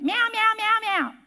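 nagging imitated meows, back to back; meowing cat. Cat pestering owner who is sorting laundry, imitated meowing. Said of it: "she would meow after you".

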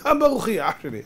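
resigned shrug. Acted. Isaac telling his son nothing can be done, resigned. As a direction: let it drop off, nothing to be done. Acted resigned repeat to Esau.

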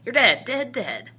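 put-on high, loud child's chant dropping at the end; taunting boy. A narrator's reading. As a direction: call it out like a boy playing gunman. Boy taunting with toy pistol.

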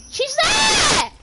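very high, loud yell at a teammate; high, frantic callout; exasperated shout. Real, not acted. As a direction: scream it to make the teammate look. Urging teammate to shoot enemy.

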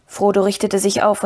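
ordinary delivery

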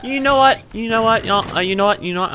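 high, loud, agitated repeats easing down; flustered. Real, not acted. Player flustered by danger in the game, high and agitated. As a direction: rattle it off high, calming slightly.

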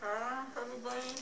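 mumbling through stuffed cheeks; cheeks stuffed full. Real, not acted. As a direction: mouth full of marshmallows, laughing. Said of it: "there's a bunch of marshmallow on my cheeks".

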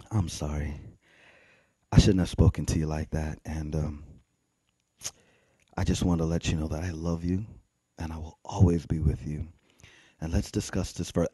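low, very soft, tender; re-enacted quiet, sincere making-up; humble apology. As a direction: apologize gently, pausing between phrases. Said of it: "So I apologize first".

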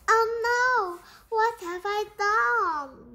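loud, high wail of dismay; horrified cry. A narrator's reading. Rat seeing the hole she made, horrified cry. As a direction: cry out in sudden horror.

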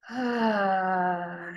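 loud, long "Ah" falling on the out-breath; voiced exhale sigh. Shown as a demonstration. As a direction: let the breath out with full sound. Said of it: "just bring some sound".

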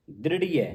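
ਦ੍ਰਿੜੀਐ ending in an open ai; correct pronunciation. Shown as a demonstration. Teacher models ਦ੍ਰਿੜੀਐ for students. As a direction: end ਦ੍ਰਿੜੀਐ on ai, not e. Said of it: "ਇਸ ਤਰ੍ਹਾਂ ਬੋਲਣਾ".

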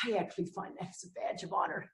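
half-voiced confiding whisper; whispered aside. Real, not acted. Speaker to the meeting, whispering an aside. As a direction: let this slip out as a secret aside.

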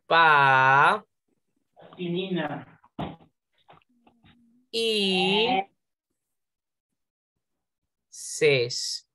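PA, Y, SES with long gaps between them; syllable by syllable. Shown as a demonstration. Teacher showing the hiatus to pupils, syllable by syllable. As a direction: hold and separate each syllable of PA Y SES. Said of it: "le permite que se separe".

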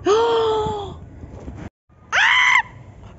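very high delighted shrieks; excited shriek. Real, not acted. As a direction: squeal out in real amazement. Voice carries across circle.

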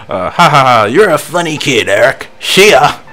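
loud trainer with a mock chuckle; lightly voiced trainer brushing someone off; trainer character voice. Trainer laughing off the kid Eric, loud character voice.

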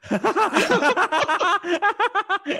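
big, loud burst of laughter. Real, not acted. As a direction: let out a hearty laugh.

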